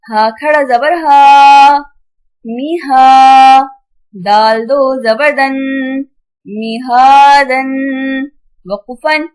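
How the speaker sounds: measured sing-song spelling, long held final syllables; Qaida spelling drill. Shown as a demonstration. Teacher spelling tanween words aloud for children, sing-song. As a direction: chant each letter and sound like a reading drill.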